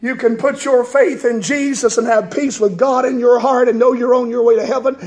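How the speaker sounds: normal talking voice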